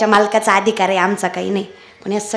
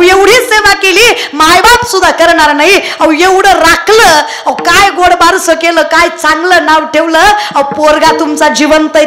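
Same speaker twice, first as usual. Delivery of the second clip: loud, higher fervent praise; preaching at full force; 6 semitones higher, 6 dB louder than usual. Acted. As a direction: pour out praise to the crowd. (तळमळीने जोरात प्रवचन)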